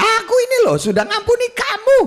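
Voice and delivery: very high, strained mock rant; mock furious forgiveness. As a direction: snap the forgiveness in a rage. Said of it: "tapi sambil ngamuk ya". (marah-marah sambil bilang sudah mengampuni)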